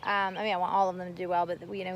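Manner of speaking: ordinary delivery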